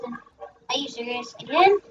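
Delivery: ordinary delivery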